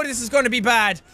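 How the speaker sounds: ordinary talk, nothing marked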